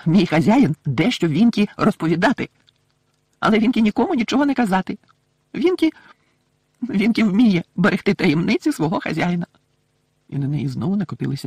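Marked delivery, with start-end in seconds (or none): 0.1-9.4 raised, loud elf voice; indignant loyal little servant; squeaky, proud refusal to tell; Winky the house-elf, vehement. Acted.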